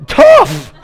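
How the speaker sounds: high, loud, dismissive squeal; comic high-pitched "tough"; mock tough shout. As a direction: blurt it out like a stingy parent. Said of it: "I'm like".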